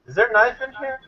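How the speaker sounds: imitating a Japanese accent in English; mock Japanese security officer accent. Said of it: "in a terrible English, Japanese accent".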